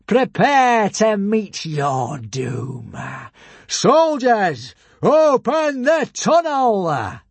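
high, loud, very drawn-out command; villainous shout. A narrator's reading. Alvin orders soldiers to open the tunnel, shouting slowly. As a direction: proclaim doom, then give a ringing order. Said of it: "shouted Alvin".

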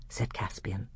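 plain reading voice

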